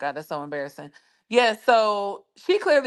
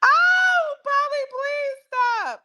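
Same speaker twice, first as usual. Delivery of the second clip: high, slow, pleading through laughter; laughing plea; much slower than usual. Real, not acted. Begging Polly to stop joking, high slow laughing plea. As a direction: beg her to stop while cracking up.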